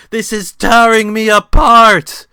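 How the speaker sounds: high, loud, slow anguished scream; Tommy Wiseau scream. Acted. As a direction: wail it out burning on the cross. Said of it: "while Tommy Wiseau screamed".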